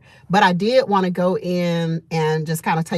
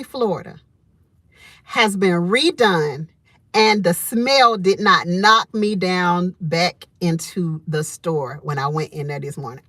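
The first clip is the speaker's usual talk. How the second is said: normal talking voice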